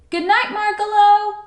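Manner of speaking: high, loud singsong call. A narrator's reading. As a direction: call goodnight to someone going away. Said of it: "called Stuart".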